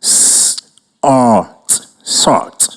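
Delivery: segmented sound-by-sound, "S-, art, sort." with unvoiced start. Shown as a demonstration. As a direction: slowly blend "S-" then "art" into "sort".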